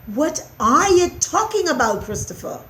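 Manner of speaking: loud, exasperated question; rising irritation; beginning to anger. A narrator's reading. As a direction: start high and drop on the name. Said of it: "beginning to get angry".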